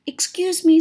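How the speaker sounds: high, loud chirpy bird call; sparrow voice. Acted. Sparrow calling politely down to Frog and Toad.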